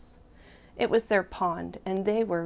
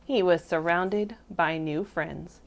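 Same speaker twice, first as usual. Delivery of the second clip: usual speaking voice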